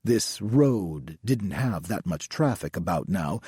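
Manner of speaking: ordinary delivery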